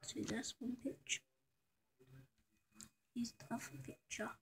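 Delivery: quick hushed whisper. Real, not acted. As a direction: whisper it quickly while showing the picture. Showing pictures on camera.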